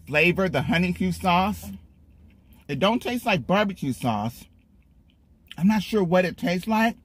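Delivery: talking between chews; slightly muffled eating speech; mouth partly full. Real, not acted. Seen 1: Lips repeatedly pressed and working between words; sandwich held; bites earlier.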